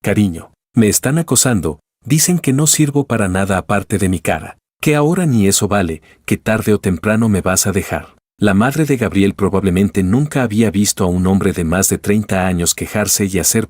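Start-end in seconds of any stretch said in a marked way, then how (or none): none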